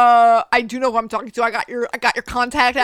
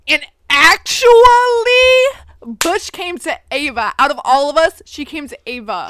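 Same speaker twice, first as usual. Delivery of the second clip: acted-out Jordan; 3 semitones higher, 11 dB louder than usual. Friend correcting Boulder in the group chat, hammed-up emphasis. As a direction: act out a pointed rebuttal, punching the first words. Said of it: "acting it out".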